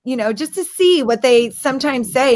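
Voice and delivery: ordinary delivery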